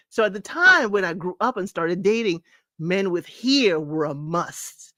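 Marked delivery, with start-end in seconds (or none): none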